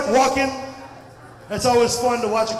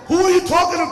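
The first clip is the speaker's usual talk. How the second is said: irritated dad; 8 semitones higher, 4 dB louder, much faster than usual. Retold or imitated. Irritated father snapping back.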